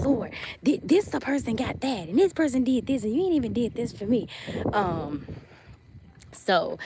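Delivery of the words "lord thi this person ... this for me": higher-pitched, hammed-up grievance; mock complaining. Imitating herself complaining to the Lord, higher and aggrieved. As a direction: rattle off a whiny complaint to heaven. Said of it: "the way I be complaining".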